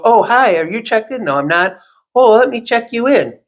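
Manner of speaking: lightly voiced old lady, suddenly all sweetness; stylist greeting the man. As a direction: mimic her sudden friendliness to him.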